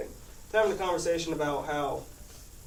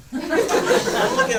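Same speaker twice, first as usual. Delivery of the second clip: words squeezed out through laughter, slow; laughing too hard to talk; 8 semitones higher, 8 dB louder, much slower than usual. Real, not acted. Retelling the doctor's sneeze advice, laughing.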